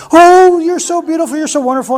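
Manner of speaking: put-on high cooing to a baby; baby talk. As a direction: coo at a rock as if at a baby, high. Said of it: "like it was a baby".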